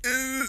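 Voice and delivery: high, soft "ugh"; tongue-out noise. Retold or imitated. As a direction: squeak out a small tongue-out sound. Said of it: "they're just like".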